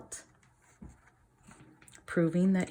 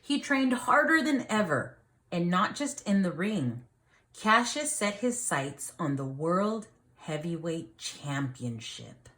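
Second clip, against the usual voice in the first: lively storybook reading for a class; reading to children; 4 dB louder than usual. A narrator's reading. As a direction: read it like a teacher reading a picture book to the class. Reads picture book aloud.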